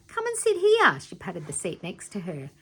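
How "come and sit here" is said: high, loud call across the room. A narrator's reading. Tiffany calling Nelson over to sit, loud and high. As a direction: call her over brightly. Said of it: "Tiffany called out".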